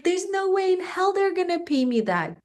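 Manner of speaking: doubtful. Retold or imitated. Doubting she'll get it.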